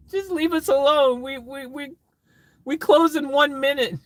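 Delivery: high, loud, whiny exasperation; exasperated worker. Acted. Drive-thru worker begging late customers to go, high exasperated wail. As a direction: plead shrilly, at the end of your rope.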